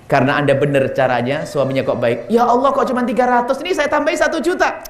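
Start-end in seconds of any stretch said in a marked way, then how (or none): 2.3-4.8 delighted husband. Retold or imitated. Generous husband adds money.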